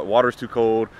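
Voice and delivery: normal talking voice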